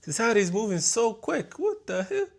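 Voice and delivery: high-pitched bewilderment; astonished. Real, not acted. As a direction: pitch climbing in disbelief. Amazed at how society changes.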